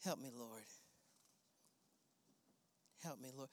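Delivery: low, soft aside to God; muttered plea. Real, not acted. Preacher quietly asking the Lord for help mid-sermon. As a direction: mumble a quick prayer under your breath.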